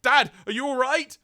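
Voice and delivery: very high, loud panicked son; hammed-up alarm for an injured father; [name]. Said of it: "we hear [name] go".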